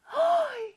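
high delighted gasp; excited gasp. Acted. Teacher gasps excitedly at the thought of dessert.

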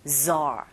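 "zar" with a drawn-out voiced z; buzzing z. Shown as a demonstration. Teacher repeats the hard syllable, "zar" with long z. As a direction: buzz into "zar" like a bee. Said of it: "이게 조금 어려우시죠".

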